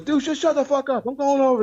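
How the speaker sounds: imitating angry friend. Caller imitating his friend Bullfrog telling him off, high mock rant.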